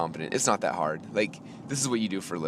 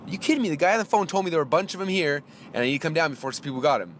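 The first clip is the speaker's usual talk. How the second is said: retold indignant complaint, pitched up; getting angry; 6 semitones higher, faster than usual. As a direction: argue it back in disbelief. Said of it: "I'm starting to get Russell angry".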